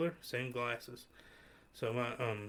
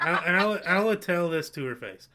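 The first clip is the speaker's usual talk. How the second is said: defiant; 5 semitones higher, 8 dB louder than usual. Real, not acted. Defiant declaration, laughing.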